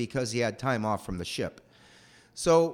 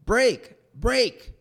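louder and higher with each "brake"; retold yelling; 6 semitones higher, 6 dB louder, much slower than usual. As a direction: yell "brake" from a distance, building. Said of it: "he's yelling at me".

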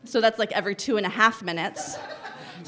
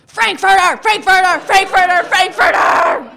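high loud shouted echo, last one slow; kids yelling back; 11 semitones higher, 11 dB louder, slower than usual. Retold or imitated. Toddlers yelling back at their mom, loud high shout. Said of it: "they yell back at me".

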